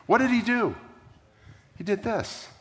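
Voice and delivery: usual speaking voice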